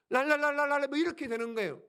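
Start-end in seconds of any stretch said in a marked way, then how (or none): 0.1-0.9 tongues babble. Retold or imitated. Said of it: "랄랄라, 막 이렇게 되는 거예요".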